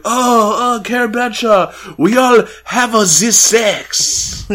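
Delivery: gushing French fan voice; French accent. Retold or imitated. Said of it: "her book pal are like".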